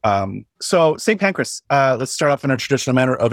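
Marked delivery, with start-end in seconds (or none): none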